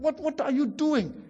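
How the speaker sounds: high-pitched, exasperated principal's telling-off; lightly acted scolding, indignant questions; mock scolding. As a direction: imitate a principal reproaching a teacher in disbelief. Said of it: "kena scolded lah. And then the principal".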